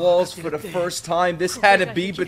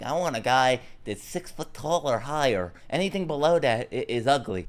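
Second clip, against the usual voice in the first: mock girl voice; 4 semitones lower, 8 dB quieter than usual. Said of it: "Girls are like".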